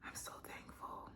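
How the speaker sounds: whispered thanks; emotional whisper. Real, not acted. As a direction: breathe it out softly. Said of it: "getting emotional".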